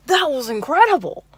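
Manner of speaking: loud, high mock gushing admiration; overzealous Bill Cosby. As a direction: gush like a smitten, overeager date. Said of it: "overzealous Bill Cosby meets".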